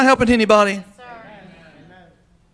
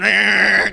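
loud buzzing imitation of an electric razor; buzzing razor noise; 9 dB louder than usual. Preacher imitating a razor vibrating in the sink, buzzing.

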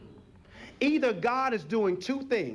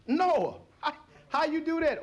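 lightly acted, higher-pitched questioning; questioning onlooker; 7 semitones higher than usual. An onlooker questions Noah about the animals, lightly acted asking. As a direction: ask it high, a hint of amazement.

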